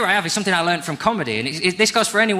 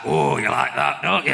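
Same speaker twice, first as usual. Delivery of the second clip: manly voice. Acted. Friend showing the beginner's line, mock manly voice. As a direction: say it like a man would. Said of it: "say it like a man would say it".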